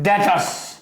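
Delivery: usual speaking voice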